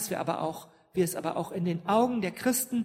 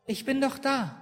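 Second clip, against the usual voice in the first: tender mother; 5 semitones higher than usual. Retold or imitated. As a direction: soothe a crying child gently. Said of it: "dann kommt die Mutter rein". (zärtlich tröstende Mutter, höher)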